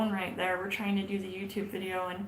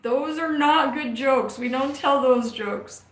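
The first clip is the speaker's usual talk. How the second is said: stern scolding; 4 semitones higher, 12 dB louder, much slower than usual. Real, not acted. Scolding him over bad jokes.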